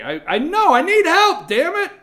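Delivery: high, loud cry of I need help; exasperated outburst. Real, not acted. Host yelling to listeners about his own problem, loud outburst. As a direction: blurt it out, half laughing at himself.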